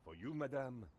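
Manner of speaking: lightly voiced French waiter accent; a courtly French barista; gracious French café accent. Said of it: "you just get a French accent".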